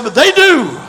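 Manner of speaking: loud insistent reply; emphatic retort. Retold or imitated. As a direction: fire it back loudly. Wife voiced retorting loudly.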